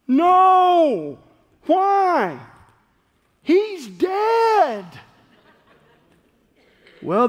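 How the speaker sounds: loud, driving questions from the pulpit; full-force preaching. Acted. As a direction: pound each question home to the congregation. Pastor questions congregation, full force.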